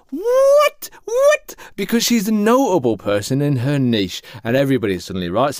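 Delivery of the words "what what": very high, squealed disbelief; cartoonish shriek of amazement; astonished crowd. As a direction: squeal it twice, pitched right up. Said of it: "And they're like".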